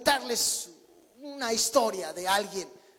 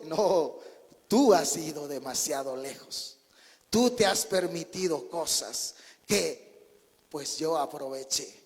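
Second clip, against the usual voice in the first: much lower, calm camel voice; lower, slower talking camel; camel's reply; 7 semitones lower, slower than usual. Retold or imitated. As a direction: answer smugly as the camel, deep down. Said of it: "el camello le responde".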